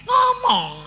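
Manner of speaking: high, loud urging; a loud, high, insistent cry; exasperated shout. Retold or imitated. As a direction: shout the order out. Retelling his urging to speak.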